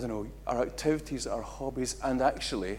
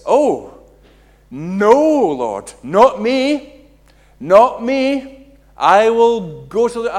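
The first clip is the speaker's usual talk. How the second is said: loud, earnest cry of loyalty; high, loud vow of loyalty, stretched out; Peter's loud protest; 9 semitones higher, 15 dB louder, much slower than usual. Acted. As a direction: protest loudly that it's not you.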